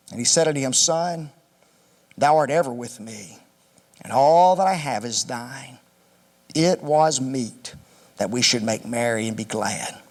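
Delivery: low, measured reading of scripture. Acted. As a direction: read the parable out as holy text. Reads the parable aloud.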